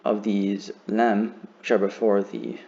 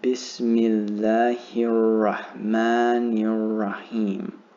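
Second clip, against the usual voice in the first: very slow, drawn-out model for learners to follow; every syllable lengthened, doubled letters held long; very slow pronunciation; much slower than usual. Shown as a demonstration. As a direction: stretch "Bismillahir Rahmanir Rahim" out at half speed. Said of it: "I'll pronounce it even slower".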